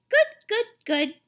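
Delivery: put-on high, loud toddler voice; little boy. A narrator's reading. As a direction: repeat it like an eager toddler. Toddler Fudge answers mother.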